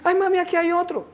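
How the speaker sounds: imitating alarmed daughter. Daughter tells mum of another intruder, imitated high alarm. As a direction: mimic a child calling out to mum in alarm. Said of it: "Cuando Vane dice".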